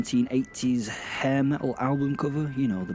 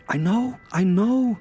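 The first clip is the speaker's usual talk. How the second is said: higher, louder "I know"; emphatic agreement; 11 semitones higher, 5 dB louder than usual. Acted. Narrator sharing disbelief at meeting Satan, emphatic. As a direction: gush it to the listeners. Mock confiding to listeners about Satan.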